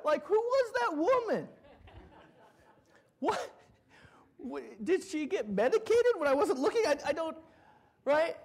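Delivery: can't believe it, still laughing; laughing disbelief. Real, not acted. Preacher tells the congregation about his mom, laughing.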